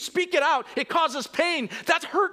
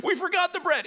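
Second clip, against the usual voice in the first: mock freak-out; 9 semitones higher, faster than usual. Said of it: "like, freak out".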